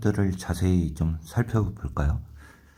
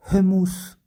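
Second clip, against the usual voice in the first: emphatic; 12 semitones higher, 5 dB louder, slower than usual. Real, not acted. Revealing the key reading.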